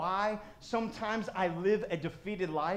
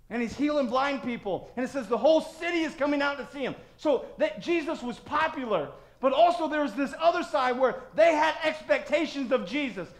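high, loud preacher's voice; full-force pulpit delivery; impassioned preaching; 7 semitones higher, 7 dB louder than usual. Real, not acted.

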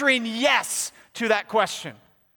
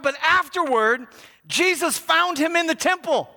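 raised, ringing pulpit reading; forceful scripture reading; 8 semitones higher than usual. A narrator's reading. Preacher reading the healed man's story to church, declamatory. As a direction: declaim the verses high and full-voiced.

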